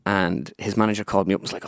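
ordinary talk

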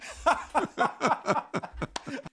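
a long high laugh at his own joke; a real high laugh after the joke. Real, not acted.

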